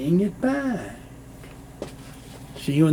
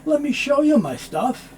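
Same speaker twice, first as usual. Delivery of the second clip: lively demo; 8 semitones higher than usual. Shown as a demonstration. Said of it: "this T sound isn't made".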